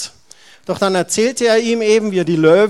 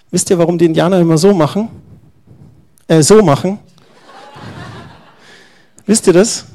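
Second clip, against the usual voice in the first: playful question; 6 dB louder, faster than usual. Real, not acted. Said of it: "asks children playfully".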